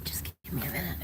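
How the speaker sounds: soft breathy whisper. Real, not acted. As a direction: whisper under your breath. Whispering in the truck.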